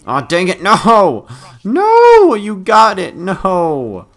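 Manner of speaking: high, loud drawn-out wail; dismayed yelling. Real, not acted. Player watching someone grab the ammo, wailing in dismay. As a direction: howl in protest. Losing heavy ammo to another.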